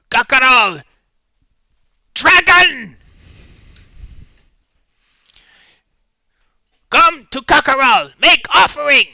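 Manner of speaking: high, loud shrieking kobold chant; shrill kobold voice. Acted. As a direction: screech it like a tiny zealous creature. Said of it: "it would sound something along the lines of".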